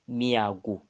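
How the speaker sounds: ordinary talk, nothing marked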